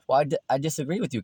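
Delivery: fast, tripping disagreement, stumbling on "di-"; hurried objection. Acted. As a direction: rush it out, tripping over "disagree". Neighbors quarrelling in sketch.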